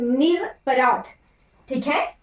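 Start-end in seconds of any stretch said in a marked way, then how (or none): 0.0-1.0 निरपराध said slowly as nir-apraadh, with a short र; teacher dictating निरपराध slowly; slow model word. Shown as a demonstration. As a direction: say निरपराध slowly, without the long आ. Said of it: "निरापराध शब्द नहीं होता".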